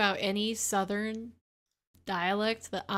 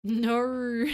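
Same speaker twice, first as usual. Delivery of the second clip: long, louder mock-Aussie NO; imitated Australian no; 3 semitones higher, 5 dB louder than usual. Shown as a demonstration. Co-host trying the Australian no, drawn-out Aussie mimicry.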